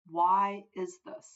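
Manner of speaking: normal talking voice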